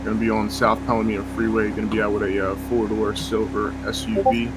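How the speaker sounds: clipped police radio call-in; nasal radio dispatcher chatter. Acted. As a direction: read it like a cop on the radio.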